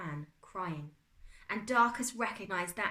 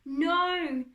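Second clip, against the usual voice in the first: loud, high drawn-out cry; anguished shout; 10 semitones higher, 9 dB louder than usual. A narrator's reading. Boy locked out from his dad, anguished shout. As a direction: wail it at a shut door.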